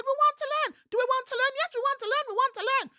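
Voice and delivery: very high, loud, squealing monkey cry; thrilled little monkey spotting a visitor; excited monkey. A narrator's reading. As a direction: shriek with delight like a little monkey. Monkeys pleading.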